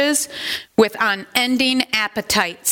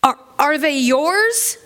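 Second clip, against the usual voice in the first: appalled; 6 semitones higher than usual. Acted. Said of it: "Scrooge startled back, appalled".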